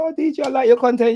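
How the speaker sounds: high, meek fanboy voice; mock timid fan. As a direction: squeak it out like a scared fan. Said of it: "You're gonna be like".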